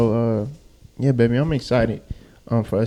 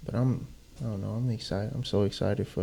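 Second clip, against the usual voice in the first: soft, hushed conversational voice; real quiet, intimate talk; talking quietly; 9 dB quieter than usual. Real, not acted. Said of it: "you got me talking all quiet".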